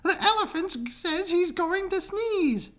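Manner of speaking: high, fretful bird voice; pleading birds. Acted. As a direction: complain in a high chirpy voice. Birds warn the bees.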